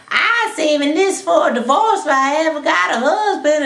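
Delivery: sing-song housekeeper announcing her gift; Annie the housekeeper. Acted. Housekeeper handing George her savings, swooping character voice. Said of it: "Annie, the Bailey housekeeper, says".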